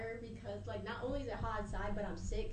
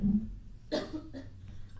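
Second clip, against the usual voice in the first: coughing; 4 semitones higher, 4 dB louder than usual. Real, not acted. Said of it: "I am sick".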